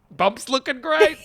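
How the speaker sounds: high, bright mock compliment; husband greeting wife, high mock-cheery. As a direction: chirp it like a cheerful spouse. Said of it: "What am I saying every morning".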